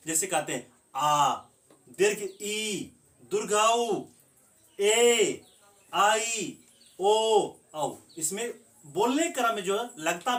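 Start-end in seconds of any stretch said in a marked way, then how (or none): 0.9-7.9 आ, ए, ओ held long and louder, one at a time; long vowel demo. Shown as a demonstration. Teacher showing students that long vowels take double time. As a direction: stretch each long vowel to twice its length. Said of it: "दोगुना समय लगता है".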